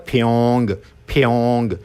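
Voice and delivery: फ्योंग said open-mouthed, from the throat; Korean word from the throat. Shown as a demonstration. Teacher shows students a Korean word, open throat. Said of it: "मुंह खोल के बोलना है गले से".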